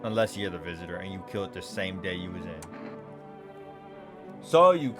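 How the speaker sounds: ordinary talk, nothing marked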